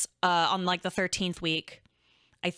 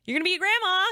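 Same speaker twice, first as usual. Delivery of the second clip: nervous announcement; 5 semitones higher, 4 dB louder than usual. Retold or imitated. Announcing pregnancy to mom.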